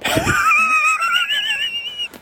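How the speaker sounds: high squealing laugh breaking out; burst of laughter. Real, not acted. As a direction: lose it in a high shrieking laugh. Laughing fit mid-story.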